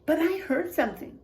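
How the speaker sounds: expressive storybook voice, quotes acted out; reading to children. A narrator's reading. Teacher reading a spooky picture book to the class, expressive. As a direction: read it like a spooky bedtime tale for kids.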